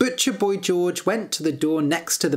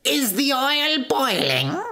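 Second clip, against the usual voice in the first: high, demanding witch voice; imperious witch, pitch raised; angry witch; 9 semitones higher than usual. A narrator's reading. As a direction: demand an answer as a menacing witch. Angry witch questions the prince.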